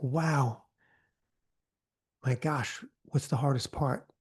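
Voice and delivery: quiet, softly amazed; gently wowed and curious. Shown as a demonstration. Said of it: "you'll be like".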